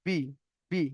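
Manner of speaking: বি said short, with no lengthening; unstretched syllable. Shown as a demonstration. Teacher demonstrates the syllable without stretch, short running বি. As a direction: clip বি right after the vowel starts. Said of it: "একদম running বললাম, এখানে কোনো টান দিলাম না".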